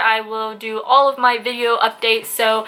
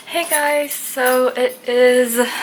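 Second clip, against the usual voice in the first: normal talking voice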